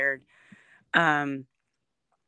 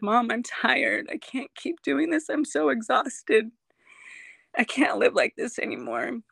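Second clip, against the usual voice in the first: emotional, near tears; 7 semitones higher than usual. Real, not acted. Retelling breakdown with her mom.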